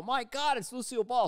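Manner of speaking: high, loud crowd amazement; starstruck exclamation. Retold or imitated. Audience spotting a star at the taping, starstruck. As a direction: gasp it out high, thrilled to recognize her.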